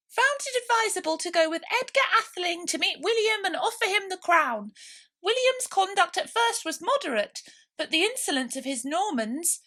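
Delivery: very high, loud hurried mouse lecturing. A narrator's reading. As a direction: rush on past the question. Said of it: "hurriedly went on".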